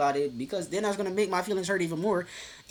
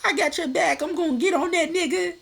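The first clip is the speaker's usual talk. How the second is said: higher, louder hyped-up friend voice, imitating; mock loyal friend; 12 semitones higher, 6 dB louder than usual. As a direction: ham up a friend swearing to have your back. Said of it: "be her friend and be like".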